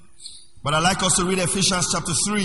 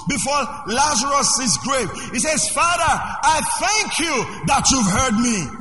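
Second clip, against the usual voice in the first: high, loud, slow preaching cry; full-force preaching; 6 semitones higher, 3 dB louder, slower than usual. Acted. Pastor preaching Jesus at Lazarus' grave, loud and slow. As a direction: proclaim it to the whole church.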